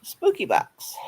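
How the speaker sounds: high playful spooky tone. Pretend. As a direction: say it like a cartoon ghost.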